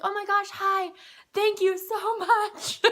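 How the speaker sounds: very high, loud, laughing thank-you; bubbling over with joy at the doctor; patient thanking her doctor, high and laughing; gushing gratitude. Retold or imitated. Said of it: "I'm always, like, so happy and so excited".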